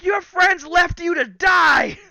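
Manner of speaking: slow, high, taunting shout; reenacted scream, drawn out and loud; screaming in his face. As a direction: yell it to intimidate him. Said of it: "I'm screaming in his face".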